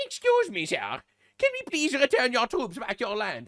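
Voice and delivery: high, polite German caricature; squeaky mock-German politeness; meek mock German. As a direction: plead timidly as a cartoon German. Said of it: "And the Germans are like".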